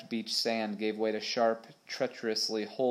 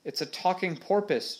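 mild narrated shout, pitched up; high, lightly raised exclamation; 7 semitones higher than usual. A narrator's reading. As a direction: call out the news in a higher voice. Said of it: "shouted James".

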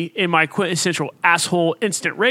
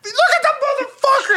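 a much higher mock yell; retold rage; faster than usual. Answering his wife's doubt, a hammed-up angry yell. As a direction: throw it back at her in pretend fury. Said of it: "all kinds of engulfed in rage".

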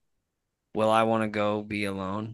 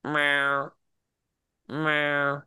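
imitating a meow; 5 semitones higher than usual. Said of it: "he goes".

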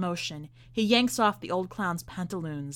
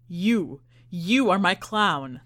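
laughing; 6 dB louder, slower than usual. Acted. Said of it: "the magician says through laughter".

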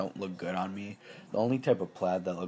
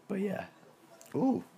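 voice cracking; 12 semitones higher than usual. Real, not acted. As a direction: voice cracks up high. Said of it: "That voice crack".

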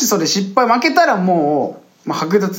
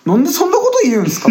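mock indignant; 11 semitones higher than usual. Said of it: "みたいな".

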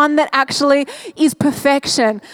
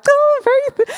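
giddy excitement; 13 semitones higher than usual. Retold or imitated. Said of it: "It's like".

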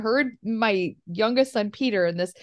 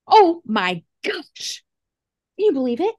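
slow, drawn-out gasp of amazement; dramatic little girl; 5 semitones higher, 4 dB louder than usual. Retold or imitated. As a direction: play the stunned four-year-old reading the news. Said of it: "she grabs it ... and goes".

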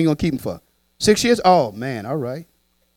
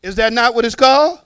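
ordinary delivery

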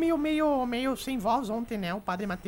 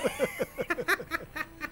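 laughing; 3 semitones higher, 4 dB quieter than usual. Real, not acted. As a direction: cackle after the punchline. Ri do final da segunda piada. (gargalhada da própria piada)

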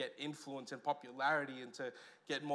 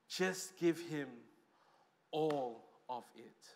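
slow, each word laid down deliberately; slow emphatic repeat; 4 semitones lower, much slower than usual. Pretend. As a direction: spell it out word by word, slowly. Same words repeated, slowed.

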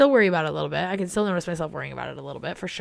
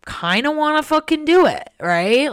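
excited resolve; 9 semitones higher, 8 dB louder, slower than usual. Retold or imitated. Decision quoted, higher, louder.

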